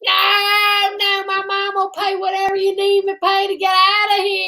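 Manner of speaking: high singsong plea; hammed-up sung begging; pleading victim.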